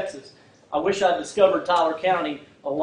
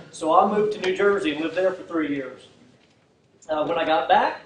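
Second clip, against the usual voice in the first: usual speaking voice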